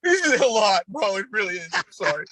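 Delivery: laughing. Real, not acted. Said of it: "doing a great job laughing".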